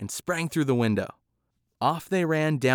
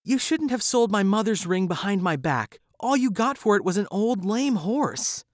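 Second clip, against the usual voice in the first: distressed woman; 7 semitones higher than usual. Acted. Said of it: "said a distressed woman".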